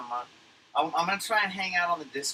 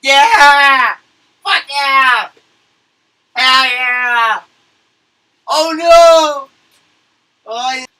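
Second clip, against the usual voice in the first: full-out yell, far louder and higher; shouting at screen; 12 semitones higher, 22 dB louder, much slower than usual. Retold or imitated. Streamer re-enacting drunken yelling at the screen, shouting. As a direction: belt out each short cheer at top volume. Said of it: "I was shouting at my screen going".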